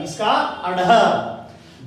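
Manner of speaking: अढ़ drawn out very slowly, with the breathy flapped ढ़; slow clear sound. Shown as a demonstration. Teacher slowly sounds out अढ़ for the class. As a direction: stretch out अढ़ so the flap is heard. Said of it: "इसका उच्चारण होगा".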